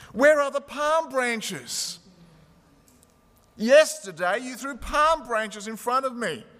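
a donkey demanding his welcome; upset donkey. A narrator's reading. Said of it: "Upset and angry".

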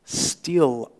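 normal talking voice

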